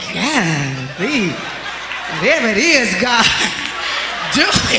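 low, laughing mock wail to God; mock desperate prayer. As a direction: imitate a desperate pray-er half laughing. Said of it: "You going".

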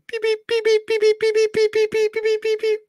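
high, piping beep beep beep; Morse code beeps. Retold or imitated. Joking about a poor Russian village, high beeping sounds. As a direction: chirp it like a telegraph machine.

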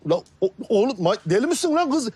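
high-pitched, agitated disbelief; alarmed protest. Acted. Friend warning the schemer she'll go mad, high alarmed protest. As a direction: protest in rising alarm at a crazy plan.

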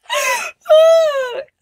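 piercingly high, loud laugh; shrieking laughter. Real, not acted. Vlogger still cracking up at the girl, shrieking laughter. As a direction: laugh till it becomes a squeal.

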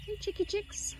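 high singsong chicken call. Real, not acted. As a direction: coo high to the hens. Calling the chickens in.

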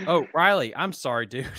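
loud, high teasing "Oh, Riley"; mock pity. Real, not acted. Teasing a buddy about his bad tournament, loud mock pity. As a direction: call out his name with put-on sympathy.

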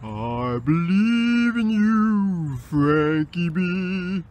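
slow, drawn-out divine pronouncement; voice of God. Acted. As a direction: intone it slowly like the Lord above. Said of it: "the Lord thy God".

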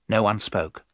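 ordinary delivery

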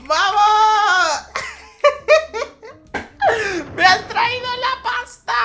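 very high, loud laughing shrieks; shrieking with laughter. Real, not acted. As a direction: squeal with gleeful laughter. Money arrives, shrieking laugh.

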